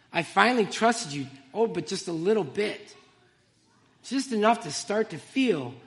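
reads her own poem aloud, heartfelt and measured; poem reading. Real, not acted. Testifying to the congregation by reading her poem, earnest recital. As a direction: let each line land like a confession. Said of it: "And I'd like to share it with you".

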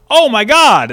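imitated daughter crying out, high and loud; child's exclamation. Daughter exclaims from around the corner, loud imitated outburst.

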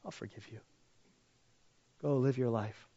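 soft, quiet reassurance; gentle God voice. Acted. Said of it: "God says".

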